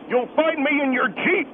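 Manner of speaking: normal talking voice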